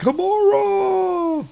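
high, drawn-out cry of alarm; freaking out. Acted. Heimdall crying out as she falls, high alarmed wail. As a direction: call the name out in mock panic. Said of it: "Heimdall's freaking out".

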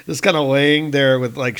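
ordinary delivery

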